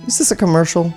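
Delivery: ordinary talk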